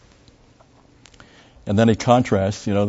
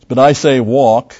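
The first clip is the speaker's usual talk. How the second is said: slow, loud scripture quote; slow emphatic verse; emphatic reading; 2 semitones higher, 6 dB louder than usual. Acted. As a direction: read it out slowly and strongly.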